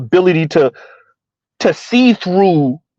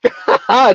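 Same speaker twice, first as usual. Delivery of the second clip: laugh; 6 semitones higher than usual. Real, not acted. Laughs at chat reply.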